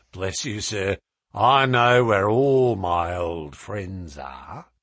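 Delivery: low, slow, knowing drawl; sinister sailor. A narrator's reading. Sailor hinting he knows old friends, slow and sinister. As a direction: draw it out low, with veiled menace.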